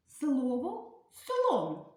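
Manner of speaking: "слово слон" said very slowly, each syllable drawn out; slow syllable demo. Shown as a demonstration. Teacher showing children the word, very slowly. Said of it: "Підставляємо і говоримо".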